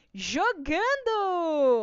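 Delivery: drawn-out triumphant announcement; anúncio arrastado. Real, not acted. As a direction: stretch the word out long and loud like a reveal. Anunciando o resultado da enquete. (anúncio bem arrastado e animado)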